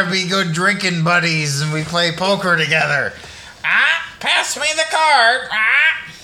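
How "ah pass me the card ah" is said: parrot voice. Pretend. Playing parrot buddy.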